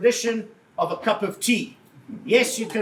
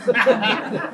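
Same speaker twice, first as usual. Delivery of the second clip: a short, loud burst of real laughter; 5 dB louder than usual. Real, not acted. Presenter laughing at the crowd's reaction, loud real laugh. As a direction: laugh out loud at your own joke. Joking with audience about discount.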